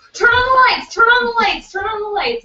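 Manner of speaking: very high, loud panicked pleading; scared. Retold or imitated. Frightened friend begging roommates for light, high panicked imitation. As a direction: mimic a frightened friend squealing urgently. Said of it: "Holly kept getting scared".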